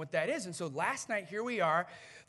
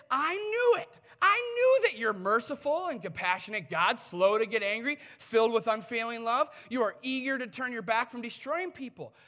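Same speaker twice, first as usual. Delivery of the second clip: angry complaint; 3 semitones higher, 6 dB louder than usual. Retold or imitated. Jonah protests to God about his mercy, angry. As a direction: spit God's virtues back as grievances. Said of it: "but he is so angry".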